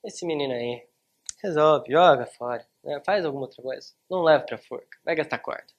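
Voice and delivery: loud, careless father brushing his son off; dismissive father. Retold or imitated. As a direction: bark it like a father who doesn't care. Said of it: "aí vai lá o pai e faz". (pai desdenhoso, falando alto)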